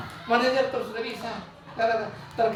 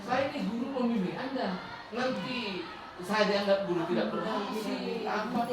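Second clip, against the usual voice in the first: soft, much quieter reproachful pleading; guilt-tripping teacher; 9 dB quieter than usual. Acted. Teacher guilt-trips a late student, soft and pleading. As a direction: make the pupil feel guilty, quietly. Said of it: "merasa bersalah, kan begini".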